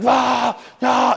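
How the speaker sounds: very high, loud mock roar; screaming rah. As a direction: bark it out like a stressed parent yelling. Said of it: "they're just screaming".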